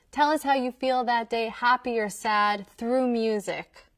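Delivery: normal talking voice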